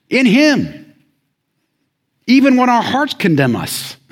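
loud, high-pitched sermon delivery; full-force preaching. Real, not acted. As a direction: preach it out with rising intensity.

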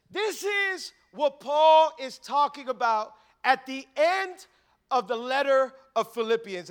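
usual speaking voice

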